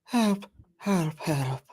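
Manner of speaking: high, small mock cry for help; Pierce Brosnan. Imitating the next narrator's scream, high restrained cry. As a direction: start high and let the helps drop. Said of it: "Pierce Brosnan".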